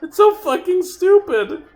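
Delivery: high voice squeezed by giggles; laughing too hard to speak normally; real laughing. Real, not acted. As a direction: talk through a laughing fit. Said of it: "makes me laugh so much".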